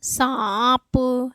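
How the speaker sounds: सांप with long nasal आ, released प; nasalised vowel demo. Shown as a demonstration. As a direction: stretch सांप through mouth and nose. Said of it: "मुंह और नाक दोनों पे बल जा रहा है".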